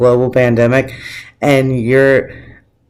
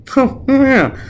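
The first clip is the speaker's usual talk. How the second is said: mocking Trump; 12 semitones higher than usual. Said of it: "And he's just like".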